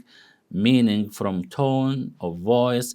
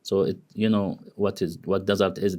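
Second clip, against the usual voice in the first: ordinary delivery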